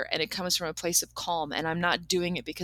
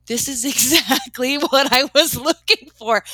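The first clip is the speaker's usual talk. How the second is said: quoting her thrilled friend, laughing, higher and louder; 5 semitones higher, 8 dB louder than usual. Retold or imitated. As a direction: laugh through it like the find was perfect. Friend thrilled about the furniture.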